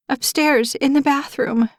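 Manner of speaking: high, anxious answer; a scared young mother pointing the way; high pitched fear; frightened mother. A narrator's reading.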